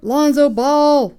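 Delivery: high, loud and slow, still shouting in delight; loud excited yell. Real, not acted. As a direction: keep yelling, thrilled by the card. Just pulled a prized card.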